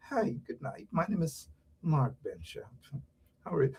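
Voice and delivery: very soft, low, polite introduction; mock a meek, stiffly proper guest; timid proper speaker. Mocking panelists who sit politely, meek and hushed. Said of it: "you sit down there like you".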